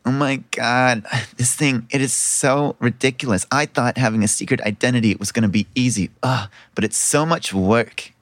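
exasperated princess's sister, pitched low; low-voiced complaining, worn out by the act; Nat. Sister griping about her secret identity, exasperated. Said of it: "You hear the other voice say".

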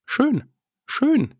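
high, delighted sighs; delighted squeal. Real, not acted. Delighted squeal over the pony's return home. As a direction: squeal with joy. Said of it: "er hat's einfach wieder nach Hause geschafft".

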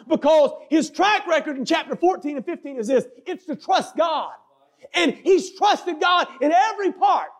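high, forceful sermon delivery; impassioned pulpit storytelling; preaching. Acted. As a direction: preach it with rising urgency and punched key words.

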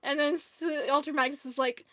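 normal talking voice

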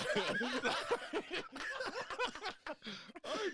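big high burst of laughter; loud laugh. Real, not acted. Laughs at own story.

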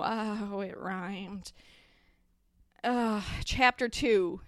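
plain reading voice

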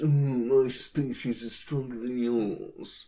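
lightly voiced smug know-it-all; a hint of a haughty snob looking down on everyone; snooty human centrist. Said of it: "the snooty human centrist".

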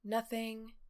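deflated. Retold or imitated. Slumped, asked what's wrong.

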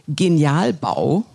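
Genialbau said slowly with a rise; slow emphatic name. Real, not acted. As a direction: stretch out Genialbau pointedly. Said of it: "ich kann's jetzt auch sagen".

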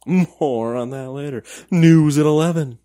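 high, loud news anchor voice; newscaster voice. Pretend. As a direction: sign off like a TV anchor. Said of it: "I was doing my newscaster voice".

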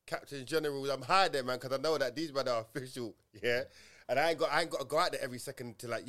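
normal talking voice

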